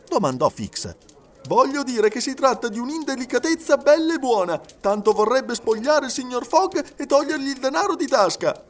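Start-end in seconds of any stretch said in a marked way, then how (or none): 1.5-8.6 high-pitched, heated rant; indignant accusation. A narrator's reading. Servant accusing the gentlemen of robbing his master, indignant.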